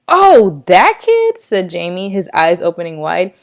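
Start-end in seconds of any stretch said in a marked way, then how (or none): 0.1-1.4 surprised recognition. Acted. Jamie suddenly recognising kid.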